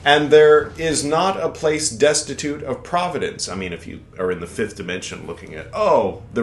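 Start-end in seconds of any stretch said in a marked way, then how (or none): 0.0-3.5 formal recitation, a touch grand; solemn text reading. Acted. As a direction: read the ancient text aloud a bit higher and louder. Reads scripture aloud.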